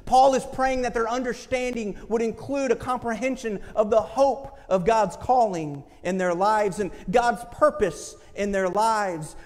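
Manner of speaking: normal talking voice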